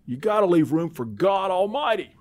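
loud, high, stretched God Almighty; booming voice. Retold or imitated. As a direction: boom God Almighty out big. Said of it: "usually it was God Almighty".

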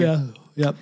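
words through a yawn; stifling a yawn. Real, not acted. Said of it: "Sorry, I'm yawning".

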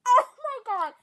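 amazed. Real, not acted. Stranger stunned by the freestyle, excited. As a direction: burst out in amazement.

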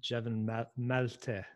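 name said with a French accent. Shown as a demonstration. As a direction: give "Malte" its French pronunciation. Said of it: "How do I do it in a French accent?".

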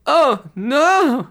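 high, loud wail of dismay; panicked monk's high wail. Acted. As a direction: cry out in horror as the bells ring. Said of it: "wails Brother Michael".

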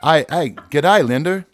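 loud, high, drawn-out Australian greeting; Australian accent. Retold or imitated. Co-host mimics Australians greeting Linda, loud broad Australian accent. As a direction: ham up a broad Australian "g'day". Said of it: "they kept saying".

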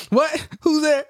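high, sudden startled yelp; high startled "who's there". Pretend. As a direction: jump at an intruder. Startled by someone entering room.

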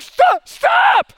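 very high, loud reenacted yell; hammed-up alarmed husband yelling; panicked shout. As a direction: shriek at the driver to stop. Retold shouting at driving wife.

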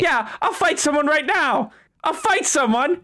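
high, eager fighter voice; eager brawler. Acted. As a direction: puff up, itching for a fight.

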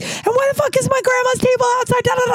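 very high screechy rant; acted-out shrieking tirade at moderate volume; so mad. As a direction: squawk the complaint in retold fury. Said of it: "I was so mad".

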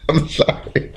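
high laugh into a breathy apology; giggling apology. Real, not acted. Podcaster sorry for fumbling the word, giggling. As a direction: apologise through helpless giggles.

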